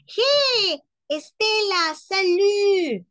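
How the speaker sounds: high, loud shout to a friend across the street; calling across the street. Pretend. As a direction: hail her from the other sidewalk. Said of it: "y le dice". (saludo gritado, agudo y fuerte, desde la otra acera)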